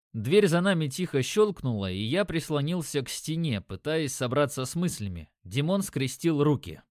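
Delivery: ordinary delivery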